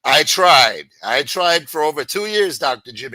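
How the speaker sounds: loud, exasperated insistence; pushing back hard at the advice; frustrated loud. Real, not acted. Streamer answering Doctor Jim, loud and exasperated.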